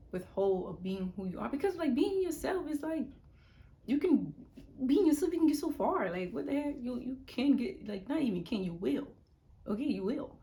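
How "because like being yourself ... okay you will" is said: high, loud pep talk; loud, high excited encouragement; excited hype. Real, not acted. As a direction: fire them up about being yourself. Excited pep talk about being yourself.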